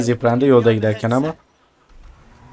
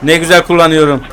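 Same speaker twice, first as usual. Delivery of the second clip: usual speaking voice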